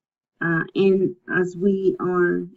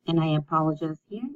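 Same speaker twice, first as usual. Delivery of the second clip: ordinary delivery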